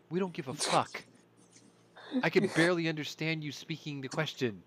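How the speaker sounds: a touch of a blunt, dismissive spokesman; mock Kirby dismissive. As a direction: toss it off like he's done listening. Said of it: "his way of saying".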